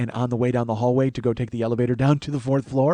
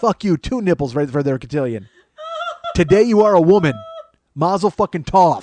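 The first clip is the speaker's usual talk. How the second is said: loud, mock-solemn blessing; mock ceremony; 5 semitones higher, 6 dB louder than usual. Shirtless guy blessing debutantes, loud mock-solemn. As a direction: proclaim it like a rabbi gone rogue.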